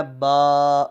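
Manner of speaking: "با" held long on a level note; sustained, unhurried "با"; long drawn-out BA. Shown as a demonstration. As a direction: stretch the vowel of "با" out. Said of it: "آپ نے اس کو پڑھنا ہے".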